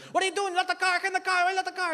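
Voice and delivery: high-pitched mock panic; frantic dad. Comic as dad berating his daughter by phone, frantic. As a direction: imitate a worked-up dad on the phone.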